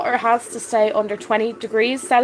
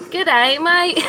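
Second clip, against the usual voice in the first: playful mock-Australian greeting; jokey, attempted Australian twang; best Australian accent; 6 semitones higher, 3 dB louder than usual. Shown as a demonstration. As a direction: put on an Aussie accent, pitched up. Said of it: "That's my best Australian accent".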